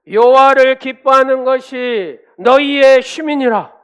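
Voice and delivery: thundering declaration, each phrase stretched out; full-force proclamation. Shown as a demonstration. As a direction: proclaim the verse at the top of your voice. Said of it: "그렇게 선포할 때".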